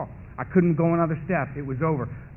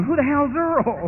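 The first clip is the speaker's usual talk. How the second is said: puzzled crowd; 9 semitones higher, slower than usual. Retold or imitated. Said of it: "The whole meeting's going".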